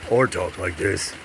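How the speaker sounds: low, deep announcer voice; deep radio voice demo. Shown as a demonstration. Showing off voices for a radio host resume, deep announcer tone. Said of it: "I can do all kinds of things".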